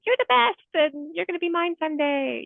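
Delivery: high, squealed crush talk; gushing adoration. Retold or imitated. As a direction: imitate yourself fawning over a favourite horse. Gushing to horses she loves.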